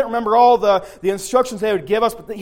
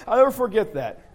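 laughing; 7 dB quieter than usual. Real, not acted. Laughing at friend fainting.

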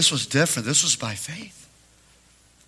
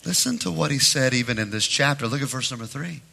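ordinary delivery

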